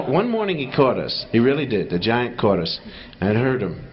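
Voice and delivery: usual speaking voice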